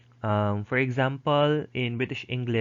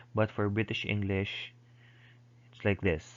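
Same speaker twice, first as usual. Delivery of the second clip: usual speaking voice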